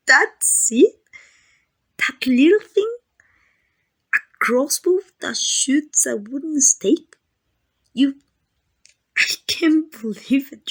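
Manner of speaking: loud, high, scornful disbelief; incredulous mockery. Vampire ridicules hunter's wooden stake, incredulous mockery.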